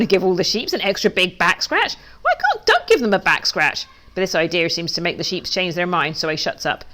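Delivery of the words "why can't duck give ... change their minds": ordinary delivery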